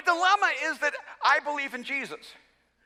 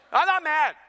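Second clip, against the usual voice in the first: higher-pitched, defensive retort; testy denial; 5 semitones higher, 4 dB louder than usual. Retold or imitated. Dad to son who asks if he's mad, testy denial. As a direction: insist on it, a touch too firmly. Denying anger during retold father-son spat.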